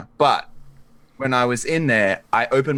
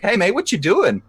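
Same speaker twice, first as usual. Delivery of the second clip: 1 friendly call across lake; 9 semitones higher than usual. Retold or imitated. Greeting old ice fisher.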